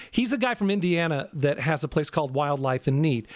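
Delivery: ordinary talk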